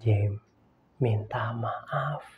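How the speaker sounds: low, soft, slow command; a hint of God gently insisting; quiet voice of God. God quietly telling Jim to apologize, low soft command. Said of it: "ngomong sama saya gini".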